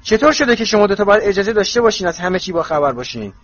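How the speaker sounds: high, heated, tumbling question; loud rushing outburst. A narrator's reading. Harry demanding why friends may know everything, loudly indignant. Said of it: "کلمات به سرعت از پس هم می آمدند".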